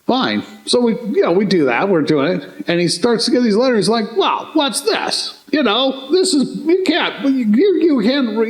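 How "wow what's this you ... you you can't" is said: high-pitched incredulous instructor; mock disbelief. Instructor looking at recruits' letters, mock disbelief. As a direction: act out an appalled instructor, voice pitched up.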